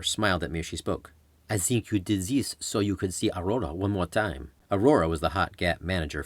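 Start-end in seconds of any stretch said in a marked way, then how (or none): 1.5-4.5 teasing in a hint of French-Canadian accent; light French-Canadian accent. Reporter teasing the patient, lightly French-Canadian accent. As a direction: tease him gently, French-Canadian lilt.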